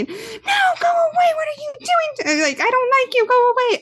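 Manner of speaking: mock the kid's meltdown, squeaky and frantic; imitating a screaming child. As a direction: mom mimicking her son screaming at her, high squeaky child voice. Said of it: "start screaming at me".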